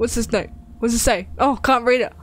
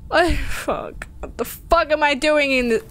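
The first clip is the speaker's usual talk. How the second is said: ordinary talk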